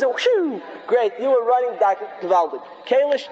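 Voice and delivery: normal talking voice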